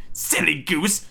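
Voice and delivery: high, scolding witch voice; old witch. Acted. Witch scolding hesitant Gretel, high chiding voice. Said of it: "said the old woman".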